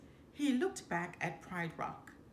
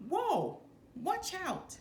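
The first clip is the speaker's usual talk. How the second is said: startled warning; 10 semitones higher, slower than usual. A narrator's reading. Voices cub Nala warning.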